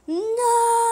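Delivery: high, loud, held wail; long scream of no. Real, not acted. Gamer protests at the game, long high scream. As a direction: hold the word high and loud.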